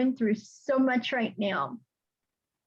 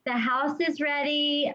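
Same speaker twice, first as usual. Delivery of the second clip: normal talking voice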